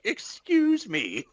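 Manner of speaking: high, slow, offended posh British; huffy British excuse me; British accent. Retold or imitated. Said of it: "British person that's gonna be like".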